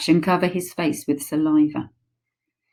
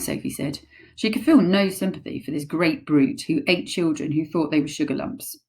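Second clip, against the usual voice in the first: ordinary delivery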